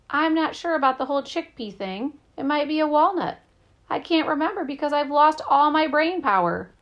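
high, loud, worked-up dismay; distressed. A narrator's reading. Rodney fretting that he lost his brain power, distressed.

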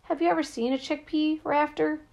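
high-pitched panic, pouring out; panicked. A narrator's reading. Rodney to Rafter about losing his brain power, panicked. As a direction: let the voice climb high with panic. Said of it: "His voice filled with panic".

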